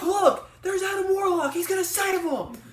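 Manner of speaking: high, loud, slow hammed-up yell; mock shout. Pointing out the rescuer to her yelling family, loud mock shout. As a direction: announce the rescue at full volume. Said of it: "so I was like".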